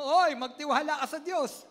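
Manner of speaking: loud urging call to a neighbour. Shown as a demonstration. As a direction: say it out loud to the person beside you. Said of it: "Lakasan mo".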